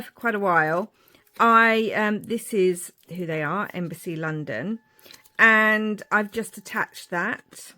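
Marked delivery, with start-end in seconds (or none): none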